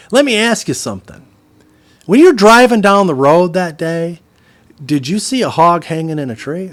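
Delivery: loud, high butcher's quiz; acted pointed questioning. Butcher quizzes customer about the hog, loud and pointed. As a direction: imitate the butcher setting up his point.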